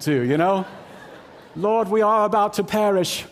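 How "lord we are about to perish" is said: high, loud hammed-up British accent; mock movie disciple with a British accent. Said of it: "It's always in a British accent".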